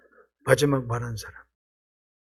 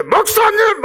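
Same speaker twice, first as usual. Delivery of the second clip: high, loud call to the pastor; 14 semitones higher, 3 dB louder, much slower than usual. Acted. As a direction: cry out to get the pastor's attention. Congregant calling out to pastor. (교인이 목사님을 크게 부르듯)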